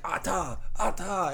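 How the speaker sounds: hammed-up caveman celebration; caveman cheer. Cavemen cheering over the fire.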